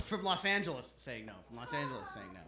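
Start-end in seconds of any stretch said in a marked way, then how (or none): 1.5-2.4 low, soft repeat of the announcement; hushed repeat. Acted. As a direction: drop low and quiet before the act. Hushed repeat before the impression.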